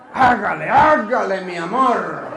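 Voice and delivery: hammed-up eager urging; mock contented lover. Preacher mimicking the happy woman urging her lover, hammed-up. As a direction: playfully egg on a sweetheart. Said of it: "Ah, muy contenta".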